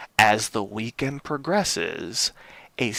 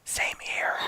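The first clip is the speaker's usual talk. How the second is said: whispering; 7 dB quieter than usual. Real, not acted. Quick whispered aside.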